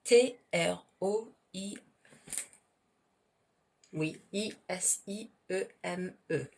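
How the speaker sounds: T R O I named one by one in French; T R O I as French letter names, spaced out for learners; spelling out letters. Shown as a demonstration. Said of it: "I don't know if you can even read this".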